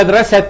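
مدرسة as madrasatun, T plus nunation; ending pronounced -tun. Shown as a demonstration. Teacher asking how we know it is marbuta, مدرسة ending in -tun. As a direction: sound a full -tun on مدرسة. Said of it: "عارف إنها تاء مربوطة".

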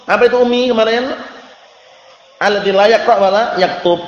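ordinary talk, nothing marked

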